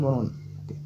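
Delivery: usual speaking voice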